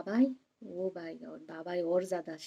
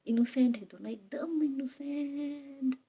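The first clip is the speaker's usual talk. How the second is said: drawn-out fond "एकदम innocent", high and slow; stretching the word with affection; fond gush; 6 semitones higher, slower than usual. Real, not acted. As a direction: coo it like talking about babies. Fond gush over elderly parents.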